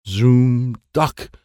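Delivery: usual speaking voice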